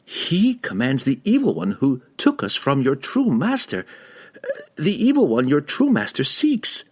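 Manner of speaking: high, pleading outcry; urgent cry. A narrator's reading. Regis warning the cat about its master's enemy, urgent high cry. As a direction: cry out to convince the listener. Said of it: "Regis cried to Gwenhwyvar".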